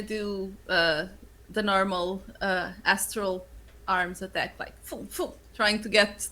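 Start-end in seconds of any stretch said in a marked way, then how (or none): 4.6-5.3 whooshing noise. Acted. Sound effect of attack.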